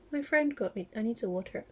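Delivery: ordinary delivery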